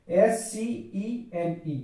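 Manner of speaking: letters spelled one by one, slowly; slow spelling out. Shown as a demonstration. Teacher spelling the word aloud for students. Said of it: "spelling the word out".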